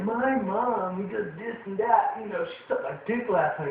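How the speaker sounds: high, slow jock voice; imitating a jock's your-mom joke. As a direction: brag like a dumb jock. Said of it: "he's always making these "your mama" jokes".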